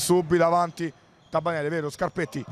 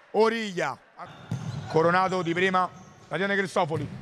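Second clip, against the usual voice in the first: usual speaking voice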